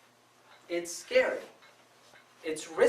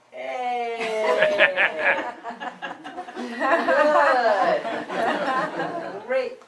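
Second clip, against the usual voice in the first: forced laugh through a bright, louder reply; fake cheerful answer; 5 semitones higher, 7 dB louder, much slower than usual. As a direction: cover misery with a put-on happy laugh. Forcing a happy answer while miserable.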